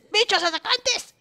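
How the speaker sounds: high, slow exasperated cry; queja exasperada. Real, not acted. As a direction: squeal it slowly in exasperation. Venting at attacking creatures. (queja aguda y alargada)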